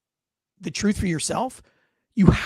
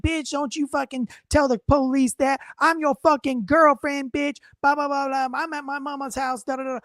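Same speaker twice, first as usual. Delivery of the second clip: higher-pitched mock yell of a woman cursing on the phone; imitating angry girlfriend; 5 semitones higher than usual. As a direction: play the furious girlfriend, pitched up, half-hammed. Woman yelling on 911 tape.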